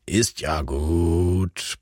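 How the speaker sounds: low, very slow drowsy murmur; a tired old man grumbling half asleep; sleepy grumble. A narrator's reading. Erich Friedrichs soothing the barking dachshund, low sleepy grumble. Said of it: "brummte die noch müde Stimme".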